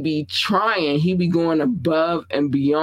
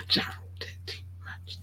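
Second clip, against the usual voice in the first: whispered aside; 14 dB quieter than usual. Shown as a demonstration. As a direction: whisper it like a secret.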